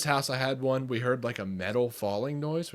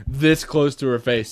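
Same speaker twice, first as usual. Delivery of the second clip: ordinary talk, nothing marked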